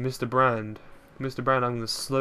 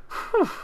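high relieved whew; 5 dB louder than usual. Real, not acted. After escaping.

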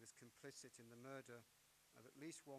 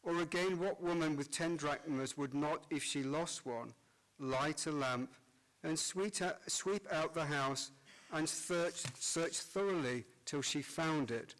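cathedral echo; 3 semitones higher, 21 dB louder than usual. Acted. Priest proclaims Gospel in church.

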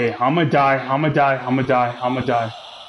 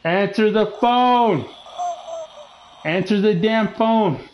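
frustrated call; 5 semitones higher than usual. Real, not acted. Frustrated calling out to game character.

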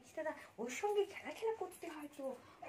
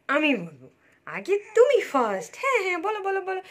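loud coaxing; 5 semitones higher, 13 dB louder than usual. Real, not acted. Mother coaxing baby loudly. (জোরে আদুরে তাগাদা)

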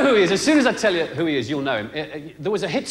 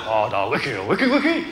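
mock Chinese babble; 5 semitones lower than usual. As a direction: rattle off the one word over and over. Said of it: "he comes back for the order".

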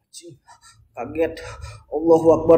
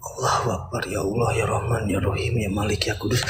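murmured low, almost under the breath; hushed prayer; 4 semitones lower, 11 dB quieter than usual. Real, not acted. Scared streamer murmuring a prayer in the dark. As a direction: pray softly to keep the fear down.

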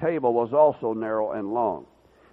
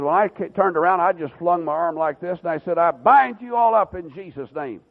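retold rebuke of spirits, higher and louder; commanding shout; 6 semitones higher, 5 dB louder than usual. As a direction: bellow it like casting out demons. Retelling binding spirits, arm flung.

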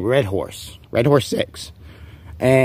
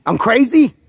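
incredulous; 12 semitones higher, 4 dB louder than usual. Real, not acted. Ranting, mock-offended at rival.

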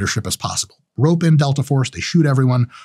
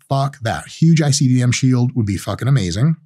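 ordinary talk

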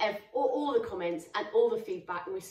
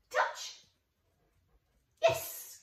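ordinary delivery

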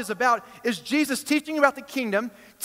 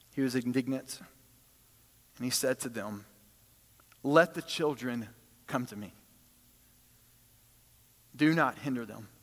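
softer, lower, gentle scripture reading; quiet reading; 8 semitones lower, 7 dB quieter than usual. Acted. Preacher quoting Jesus to his disciples, quiet and reverent. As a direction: read the words softly and reverently. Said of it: "he's emphatic".

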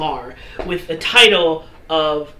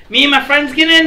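Fluffy asking; 8 semitones higher, 6 dB louder, faster than usual. Acted. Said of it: "He's like".

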